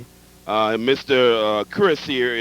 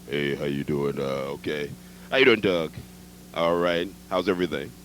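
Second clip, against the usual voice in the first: much lower deep voice; deep man's voice; 9 semitones lower, 6 dB quieter than usual. Retold or imitated. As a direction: drop low to sound like him. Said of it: "a man that has a voice like".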